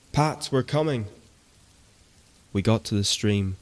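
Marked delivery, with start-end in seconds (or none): 0.1-1.1 much higher urgent shout. Acted. Lads running to the stream shouting for Pat, high urgent shout. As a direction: yell it while running. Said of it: "shouting his name".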